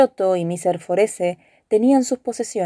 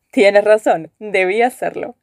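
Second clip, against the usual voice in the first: ordinary delivery, nothing marked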